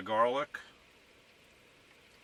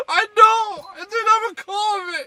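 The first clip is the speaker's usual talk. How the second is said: very high, much louder falsetto squawk demanding a call; nagging caller; 14 dB louder than usual. Acted. Pretending to be someone ignored by family, shrieking in falsetto. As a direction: shriek it like an offended relative who never gets phoned.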